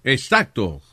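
usual speaking voice

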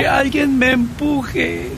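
put-on high, slow slurred drunk calling; town drunk. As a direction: call out like a drunk in the rain. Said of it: "un borracho gritaba".

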